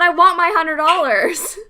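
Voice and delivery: high, loud angry snap; furious. Retold or imitated. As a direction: spit it out in fury. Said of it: "I was so angry".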